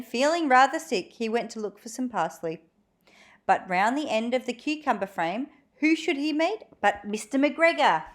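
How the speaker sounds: plain reading voice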